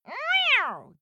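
cat meow, high and loud. Acted. Narrator voices the cat.

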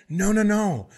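ordinary delivery

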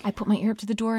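ordinary talk

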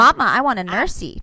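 a hint of babyish pleading. Son asking mom to nurse at coffee shop, light baby voice. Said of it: "talking in a baby voice".

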